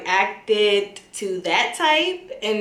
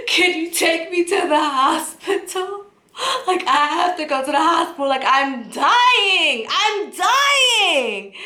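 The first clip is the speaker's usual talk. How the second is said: crying, freaking out; 7 semitones higher than usual. Retold or imitated. Panicking patient to cousin about hospital, tearful pleading. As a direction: whine it high and stretch out the word dying. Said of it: "I'm still crying".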